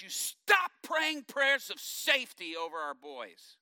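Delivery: loud, slow, firm order; stern command. Retold or imitated. Wife interrupting her husband's prayer, loud and slow. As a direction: stop him cold with every word. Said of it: "she interrupts my prayer and she goes".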